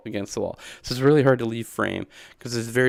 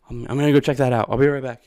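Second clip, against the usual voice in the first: ordinary talk, nothing marked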